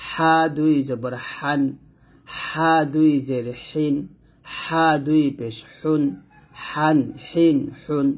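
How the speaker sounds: slow "হান, হিন, হুন" squeezed from mid-throat; drawn-out, constricted throaty "হা"; pressed thin "হা". Shown as a demonstration. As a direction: press the breath on every h of "হান". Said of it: "চাপ দিয়ে চিকন করে উচ্চারণ".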